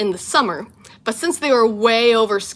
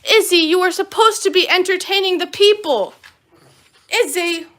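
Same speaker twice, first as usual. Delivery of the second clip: pet scolding; 8 semitones higher than usual. Real, not acted. Scolding her dog.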